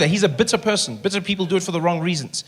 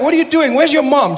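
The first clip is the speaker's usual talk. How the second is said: scolding; 8 semitones higher, 4 dB louder than usual. Pretend. Scolding bleeding player.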